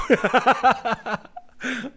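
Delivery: high, loud nervous laugh. Real, not acted. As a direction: burst out in a high, uneasy laugh. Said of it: "very nerv-, inter-, interler-, nervous laugh".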